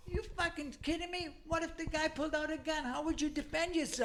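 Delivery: high-pitched exasperated scolding; imitated incredulous father. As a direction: tear into the excuse like an appalled dad. Father rejects son's excuse, retold.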